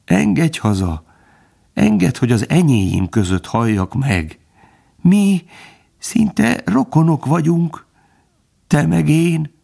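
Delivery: high, plaintive begging; humble pleading. A narrator's reading. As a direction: lament and beg as a dying kinsman. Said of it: "A róka még alázatosabb lett kérelmében". (alázatosan könyörögve)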